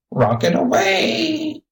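high, drawn-out bird squawk; budgie squawk. A narrator's reading. Budgie squawks to show he is all right, shrill squawk. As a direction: squawk it like a parrot, stretching the last word. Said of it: "he squawked".

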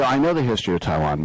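fast, indignant retort; defensive. Real, not acted. Rick defending his Taiwan knowledge, fast. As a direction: rattle it off defensively. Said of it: "defensive retort".

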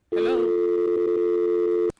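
loud, drawn-out "Hello?"; calling out. Real, not acted. Radio host calling loudly to a caller who won't answer. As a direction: call down a dead phone line.

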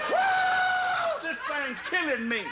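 piercing high yell then frantic cry; pained holler. Retold or imitated. John yelling down while the lynx attacks. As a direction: holler for help in the treetop. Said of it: "he kept hollering".

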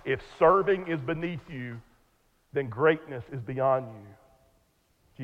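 "greatness is beyond you" drawn out very slowly; slow, weighty restatement for emphasis; emphatic repeat. Shown as a demonstration. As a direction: repeat it deliberately, pausing after "beneath you" and stretching "then greatness is beyond you". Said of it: "I'll say it one more time".

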